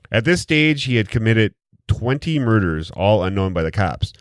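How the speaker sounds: usual speaking voice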